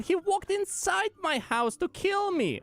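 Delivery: high whiny cartoon complaint; exaggerated cartoon intonation; cartoon character voice. Complaining to admin in voice.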